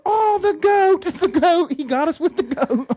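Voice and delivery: very high, slow, loud voice; mock crowd reaction. Retelling the party crowd, very high, slow and loud. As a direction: stretch it out in a silly high voice. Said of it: "They're like".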